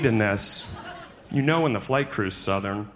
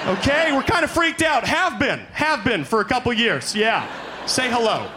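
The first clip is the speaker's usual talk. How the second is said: mock panic; 10 semitones higher than usual. Scared flyer begging the silent pilot to say hello. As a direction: shout up to the cockpit like a scared flyer.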